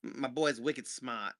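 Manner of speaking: slow Boston drawl on wicked smart; mock Boston tough guy; Boston accent. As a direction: lay on a thick Boston accent. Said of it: "for your next Boston accent".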